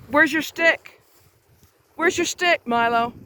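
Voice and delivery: high-pitched questions to a pet; coaxing dog talk. Real, not acted. Coaxing dog toward its stick.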